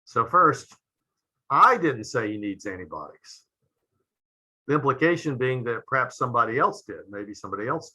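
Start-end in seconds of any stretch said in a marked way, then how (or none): 1.5-3.4 I said with weight, rest falls away; stress on I. Shown as a demonstration. Lecturer demonstrating inflection to the class, stress on I. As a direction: lean on the word I, implying someone else said it. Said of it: "notice how the meaning changes depending upon the inflection".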